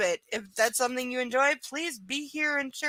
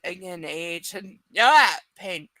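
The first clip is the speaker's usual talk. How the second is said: normal talking voice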